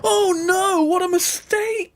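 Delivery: put-on high, loud panicky kids; hammed-up alarm; mock panic. Kids realising the wolf is in, high mock panic. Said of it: "cried all the kids".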